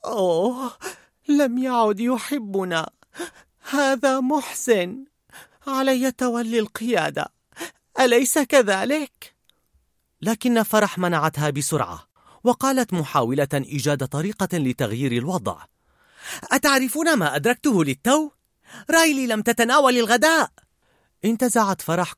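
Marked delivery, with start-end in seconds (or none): 0.0-9.4 high, slow, mournful character voice; woeful, dragging self-pity; Sadness. A narrator's reading. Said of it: "وصعدت حزن إلى وحدة التحكم وهي تتمتم". 16.5-20.5 high, animated character voice; perky, much higher and insistent; eager realization. Acted. Joy hurries to change the mood.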